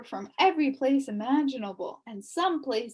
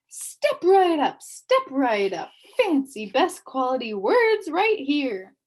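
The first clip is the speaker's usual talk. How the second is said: loud booming pitch, higher and slow; booming salesman; 4 semitones higher, 7 dB louder than usual. A narrator's reading. Salesman calling shoppers to his stall, booming. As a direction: boom it out like a fairground barker. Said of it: "announced one man in a booming voice".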